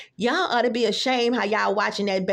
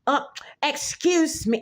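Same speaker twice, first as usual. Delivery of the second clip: put-on German accent; 5 semitones higher, slower than usual. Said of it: "German accent".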